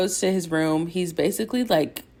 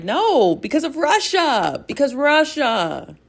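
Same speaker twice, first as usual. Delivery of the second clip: Ilya's refusal; 9 semitones higher, slower than usual. Retold or imitated.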